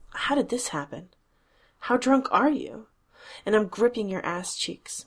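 usual speaking voice

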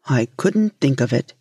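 lightly voiced low fisherman; a hint of an unbudging fisherman. A narrator's reading. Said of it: "said the fisherman".

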